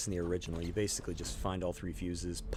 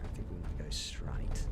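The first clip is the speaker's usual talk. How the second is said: soft, lower whisper; whispered aside; 7 semitones lower, 4 dB quieter than usual. Real, not acted. Guiding her character quietly, whispering. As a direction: breathe it like a secret.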